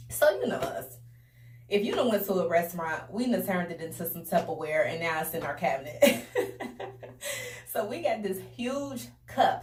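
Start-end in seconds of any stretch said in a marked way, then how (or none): 6.3-7.7 a sudden high burst of laughter. Real, not acted. Telling her viewers the story, a high burst of laughter. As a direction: laugh out at the memory.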